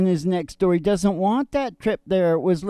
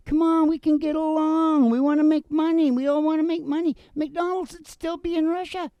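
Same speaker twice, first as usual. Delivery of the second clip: higher, smooth salesman voice playing nice; slick talker to Putin; 8 semitones higher than usual. A politician sweet-talking Putin, in a higher smooth voice. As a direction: coax him along like a slick dealmaker. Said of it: "He needed to be slick with Putin".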